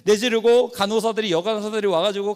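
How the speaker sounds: normal talking voice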